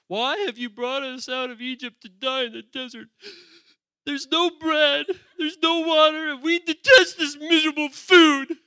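whining complaint. Acted. Israelites complaining to Moses in the desert, whining loudly. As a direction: whine it out, rising to a loud, tearful protest. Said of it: "They're whining a little bit".

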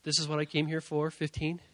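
soft, low-key questioning; subdued disbelief. Retold or imitated. Driving home, pastor questions God, quietly disbelieving. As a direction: grumble privately in prayer. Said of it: "having this discussion with God".

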